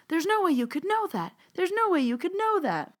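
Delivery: very high, squealing no way repeated; mock giddy astonishment; amazed disbelief. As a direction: imitate her astonished gushing. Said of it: "It was adorable".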